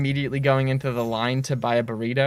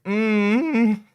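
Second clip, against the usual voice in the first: savoring mm; 7 semitones higher, 3 dB louder than usual. Retold or imitated. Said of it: "I'm just like".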